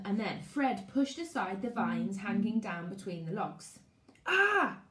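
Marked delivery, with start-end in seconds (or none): 4.3-4.8 sharp, high, loud yelp of pain; pained cry. A narrator's reading. Fred pushing aside the vines, sharp high yelp of pain. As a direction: cry out as something stings. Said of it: "He winced".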